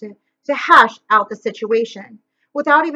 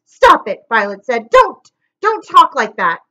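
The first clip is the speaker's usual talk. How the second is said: pleading cry; 7 dB louder than usual. A narrator's reading. Violet begging Stefano to stop mocking, loud plea. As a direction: beg him to stop, voice raised.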